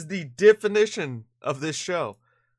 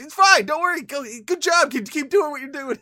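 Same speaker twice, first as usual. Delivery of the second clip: high, bright encouragement; cheery mock encouragement; 10 semitones higher, 4 dB louder than usual. Host imitating Andrew Luck cheering on the defender, higher. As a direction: reassure him like a cheerful coach.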